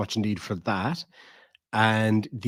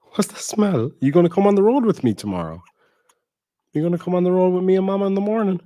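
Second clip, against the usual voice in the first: high, fast, bouncy puppy talk; 9 semitones higher, 5 dB louder, faster than usual. Real, not acted. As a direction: gush at the dog excitedly. Excited baby talk to dog.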